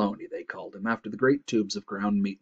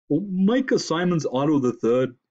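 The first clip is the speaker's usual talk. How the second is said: loud, stuffy self-introduction; congested moose; 6 semitones higher, 5 dB louder than usual. A narrator's reading. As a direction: announce your name through a head cold.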